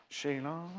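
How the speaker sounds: soft, drawn-out "Sheila"; drawn-out name-calling. Pretend. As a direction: stretch the last vowel softly, tune rising. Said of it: "why am I saying that like that".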